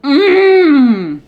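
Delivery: high, loud tasting moan; loud delighted mmm. Real, not acted. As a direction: savour the first bite aloud. Tasting the ice cream.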